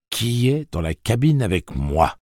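stern train driver calling out. Acted. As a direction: challenge the stowaway sternly. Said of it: "d'une voix sévère".

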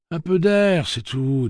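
bright, high, falsely cheerful; forced smile. A narrator's reading. Susanna brushing off Roland's concern, forced smile. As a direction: hide the pain behind a smile. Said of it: "dit-elle en souriant d'un sourire qui parut un peu faux".

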